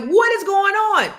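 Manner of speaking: high, loud, exasperated yell; alarmed shout. Retold or imitated. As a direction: demand answers at the top of your voice. Mom confronting her yelling sons.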